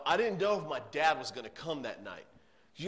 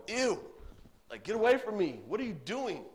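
high, louder mock disgust, pulling away; disgusted recoil; 7 semitones higher, 3 dB louder than usual. Preacher mocking a disgusted response to the leper: high, loud recoil. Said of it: "Jesus doesn't go".

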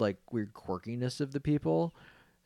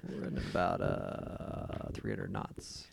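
airline pilot announcement; 13 semitones lower, 8 dB quieter, much slower than usual. Pretend. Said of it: "Start talking like a pilot".

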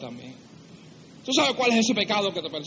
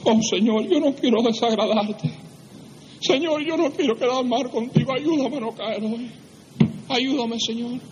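higher-pitched pleading to God; pleading morning prayer; 4 semitones higher than usual. Acted. Preacher acting a believer's morning prayer, pleading. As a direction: plead as if praying earnestly. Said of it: "en la mañana a decir".

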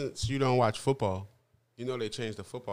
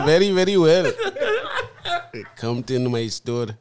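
Indian accent; 7 semitones higher, 14 dB louder than usual. Shown as a demonstration. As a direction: lay on a thick Indian accent. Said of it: "I can do the Indian accent".

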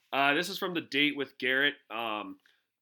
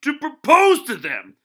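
loud, higher, slow sports-pundit rant; Stephen A Smith; 11 semitones higher, 16 dB louder, much slower than usual. Retold or imitated. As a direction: build up like an outraged TV pundit on air. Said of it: "read it in a Stephen A Smith voice".

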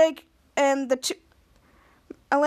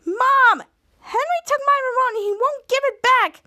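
put-on high, loud kid calling for mom; acted whiny tattling; 11 semitones higher, 4 dB louder than usual. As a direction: wail to mom about a brother. Sister tattles to mother.

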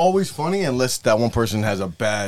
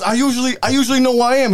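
higher, laughing imitation of a lost trucker; mock lost trucker; 10 semitones higher, 4 dB louder than usual. Quoting the lost truck driver to friends, higher and laughing. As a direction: play the dazed trucker through laughs.

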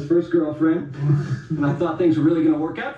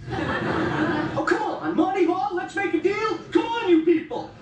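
pleading with the crowd; slower than usual. Acted. Coaxes a silent crowd.

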